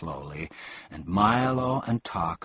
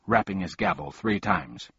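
ordinary delivery, nothing marked

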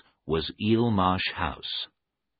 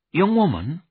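lightly voiced, higher startled echo; a touch of disbelief, raised pitch; startled questioning; 8 semitones higher, 4 dB louder than usual. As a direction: query it in surprise, pitched up.

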